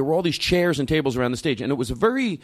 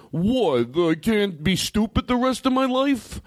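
mocking quote; 7 semitones higher, slower than usual. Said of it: "when people go".

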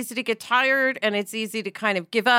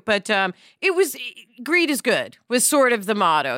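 normal talking voice

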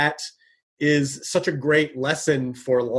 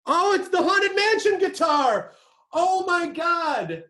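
excited shout; slower than usual. Real, not acted. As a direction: shout it out, thrilled and amazed. Sees a prized guitar on camera.

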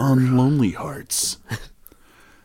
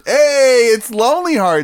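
high, loud host intro; show host voice; 14 semitones higher, 8 dB louder than usual. Pretend. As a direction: announce the segment like a host.

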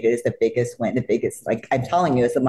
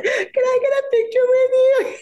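high, louder tearful ask; teary plea; 4 dB louder than usual. Retold or imitated. Fan asking her idol for a photo, high and tearful. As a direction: beg for a photo through tears.

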